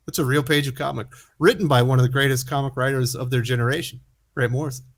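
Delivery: normal talking voice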